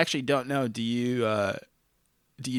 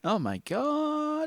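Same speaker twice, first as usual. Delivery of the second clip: amazed; 5 dB quieter, much slower than usual. Real, not acted. Amazed at ventriloquism joke.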